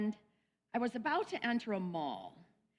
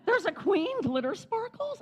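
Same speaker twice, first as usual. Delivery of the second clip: much higher, loud disbelieving question; stunned surprise; 13 semitones higher, 3 dB louder than usual. Retold or imitated. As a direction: squeal it out in disbelief. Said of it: "And I went".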